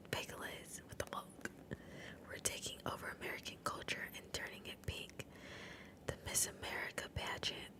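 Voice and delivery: hushed, voiceless ASMR narration; whispered story reading. Acted. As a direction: read the story entirely in a whisper.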